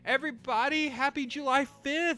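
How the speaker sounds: bright showy host greeting; announcer voice. Acted. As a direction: greet the audience like an emcee. Host persona holiday greeting.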